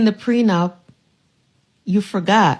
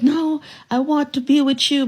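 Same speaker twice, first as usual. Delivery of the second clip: higher, whiny imitation of a begging husband; wife imitating husband begging to stay, higher and whiny; mock pleading husband; 7 semitones higher than usual. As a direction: mimic him pleading.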